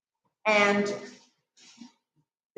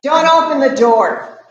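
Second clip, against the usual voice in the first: loud warning; 2 semitones higher, 11 dB louder than usual. Acted. Dramatic warning in performance.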